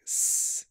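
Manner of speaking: long hissed S sound, no voice; snake hiss S. Shown as a demonstration. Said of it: "looks a bit like a snake".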